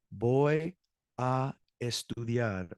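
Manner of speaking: "Voy" held, then a pause before "a estudiar"; slow, separated model answer for learners; word-by-word. Shown as a demonstration. Teacher answering what you'll do tonight, word-by-word.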